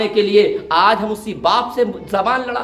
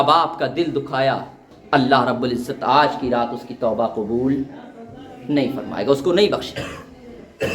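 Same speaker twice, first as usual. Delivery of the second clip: much lower and hushed, grave warning tone; solemn preaching; 9 semitones lower than usual. Acted. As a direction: lower into a heavy, sombre warning. Grave warning about unaccepted repentance.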